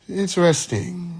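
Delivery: hammed-up British accent; British accent, elongated vowels. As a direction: stretch it out in a mock-British voice. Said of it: "end with an elongated vowel sound".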